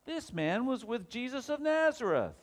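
high, loud finger-pointing cry; loud accusation. Acted. Servant girl telling the bystanders about Peter, loud accusation. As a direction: call it out to accuse him before everyone.

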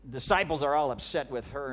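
ordinary delivery

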